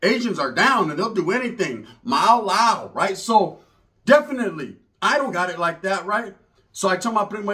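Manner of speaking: normal talking voice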